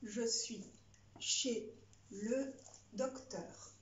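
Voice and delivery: dictating. Shown as a demonstration. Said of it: "C'est parti".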